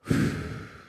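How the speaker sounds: long audible exhale; re-enacted deep breath. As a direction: blow out a breath, acting out a short pause. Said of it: "to take a breath".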